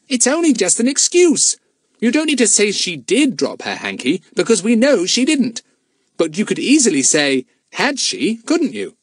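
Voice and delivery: mildly impatient schoolboy. A narrator's reading. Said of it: "said Fatty impatiently".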